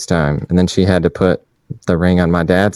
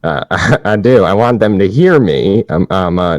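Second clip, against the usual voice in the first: ordinary delivery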